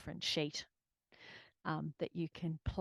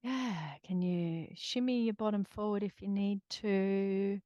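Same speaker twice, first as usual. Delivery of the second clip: gentle guided grounding voice; calm grounding exercise; 2 semitones higher, 4 dB louder than usual. Acted.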